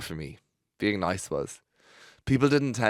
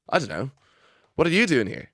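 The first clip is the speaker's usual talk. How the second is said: put-on teasing Irish accent, pitched higher; mocking Irish accent; 7 semitones higher, 5 dB louder than usual. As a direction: mimic her Irish accent back at her. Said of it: "back in a mocking Irish accent".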